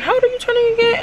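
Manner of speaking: high-pitched voice for her question; imitated curious woman. Retelling the K T woman's question, high-pitched imitation. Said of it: "So she was like".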